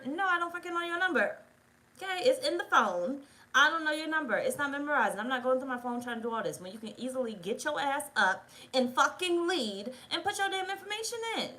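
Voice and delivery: heated, rising, sharp comeback; angry retort. Retold or imitated. Woman fires back at her date about the phone number, angry. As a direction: tell him off for making you do it.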